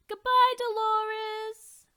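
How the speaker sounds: high, loud, slow farewell; high loud drawn goodbye; sing-song goodbye. Acted. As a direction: call it out cheerily. Friends bid farewell.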